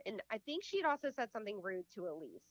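ordinary talk, nothing marked

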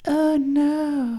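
very high, drawn-out "oh no"; mock dismay. Co-host imitates worried listeners, in high mock dismay. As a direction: exaggerate a worried listener's wail. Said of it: "Do you think anybody's like".

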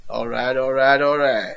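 low laid-back 'all right' catchphrase; Matthew McConaughey voice. Retold or imitated. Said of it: "He's just gonna turn up as the Green Goblin and be like".

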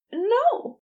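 sudden high, loud No; exclaimed. A narrator's reading. Nurse blurting a protest at the florist, high and loud. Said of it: "Aziraphale exclaimed".

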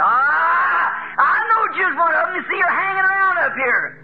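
high, taunting heckler voice; mocking heckler. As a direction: jeer at the old man from below. Heckler mocks Noah in sermon.

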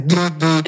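low doubled "du" for message alerts; low-pitched spoken notification noise; imitated phone buzz. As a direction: mimic a phone pinging twice.

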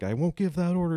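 high, loud defiant yell; shouting. Retold or imitated. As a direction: scream the refusal. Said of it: "shouting at each other".

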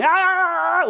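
sudden high loud yell of rage; enraged shout. Shown as a demonstration. Preacher shows the crowd drowning out Stephen, loud yell. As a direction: blast out an angry scream. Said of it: "start shouting".

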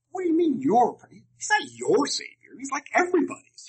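high, incredulous voice; indignant young self. Retold or imitated. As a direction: object like a scandalised kid. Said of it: "I said".

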